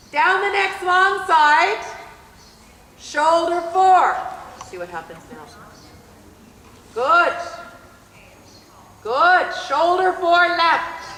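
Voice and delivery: loud, drawn-out arena commands; brisk projected riding commands. Acted. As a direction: call it out across the arena.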